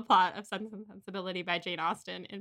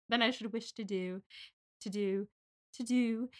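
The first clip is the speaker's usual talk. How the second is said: softly mimicked British audiobook narrator; 5 dB quieter than usual. Host savouring an audiobook narrator's line endings, British accent. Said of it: "the narrator was British".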